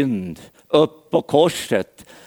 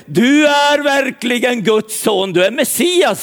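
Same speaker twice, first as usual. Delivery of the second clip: pitched up, in awed excitement; amazed confession; 7 semitones higher than usual. Acted. Nathanael to Jesus, excitedly hailing him as Messiah. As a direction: proclaim it as a stunned discovery.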